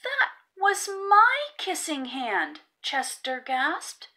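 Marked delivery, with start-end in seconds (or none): 0.0-2.6 put-on high, slow, heartbroken child's voice; little raccoon crushed, speaking to his mother; saddest voice. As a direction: let it break like a hurt little boy. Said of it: "in the saddest voice his mother".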